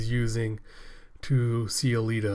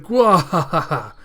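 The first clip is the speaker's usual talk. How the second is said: evil villain laugh; 5 semitones higher, 8 dB louder than usual. Acted. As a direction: laugh like a gloating monster. Makaku laughs at surprises.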